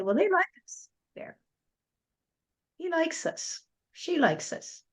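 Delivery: high, soft little voice of the grass blades. Acted. Grass blades welcoming the speaker, in a high soft voice. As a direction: lift the voice up small and light for the happy grass. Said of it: "And they'll say".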